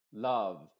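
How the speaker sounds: LOVE with a short 'uh', as LUHV; LOVE with an unrounded neutral vowel; modified U. Shown as a demonstration. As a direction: say LOVE as LUHV, lips still. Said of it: "Te fijas, no es love".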